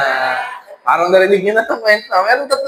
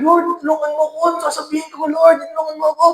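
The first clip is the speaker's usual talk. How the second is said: higher, anxious pleading prayer; frightened prayer; 6 semitones higher, faster than usual. Acted. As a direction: beg quickly, fearing you are next. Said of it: "dasal siya". (takot na pagsusumamo sa Panginoon)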